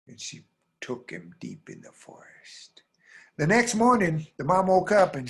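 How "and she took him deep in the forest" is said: slow, eerie storytelling whisper; hushed whisper. A narrator's reading. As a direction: whisper it slowly, like a dark secret.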